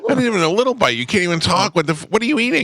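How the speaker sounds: high-pitched exasperated scolding. Real, not acted. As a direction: scold him in disbelief. Scolds co-host eating on air.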